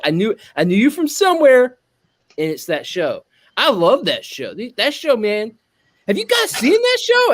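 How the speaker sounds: gushing fan, pitch leaping up; fanboying. Retold or imitated. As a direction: imitate an excited fan who just placed a face. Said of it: "One dude was totally fanboying".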